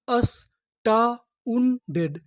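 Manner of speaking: very slow, every syllable; slow drawn word. Shown as a demonstration. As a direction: sound out अस्टाउंडेड slowly. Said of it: "तो हमारी पूरी meaning क्या बनी?". (धीरे-धीरे खींचकर)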